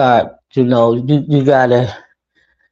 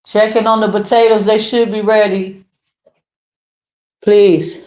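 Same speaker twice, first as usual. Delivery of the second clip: higher-pitched aside to someone nearby; calling out; 7 semitones higher than usual. Real, not acted. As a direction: call out a kitchen request, pitched up. Household instruction to someone off-mic.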